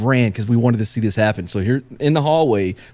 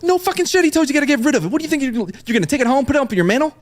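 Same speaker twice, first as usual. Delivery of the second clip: high-pitched incredulous scolding; hammed-up exasperation, voice high throughout; imitating exasperated sergeant; 12 semitones higher, much faster than usual. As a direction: tear into a foolish junior, pitched way up. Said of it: "the platoon sergeant's like".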